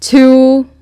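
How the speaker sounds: loud, drawn-out warning count; threatening countdown. Acted. Game master as furious woman threatening to smash furniture, loud countdown. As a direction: count down loudly as an ultimatum.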